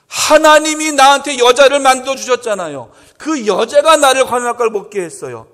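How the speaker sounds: loud, aggrieved finger-pointing; indignant blaming. Acted. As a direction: whine it was not my fault. Adam blaming God, acted.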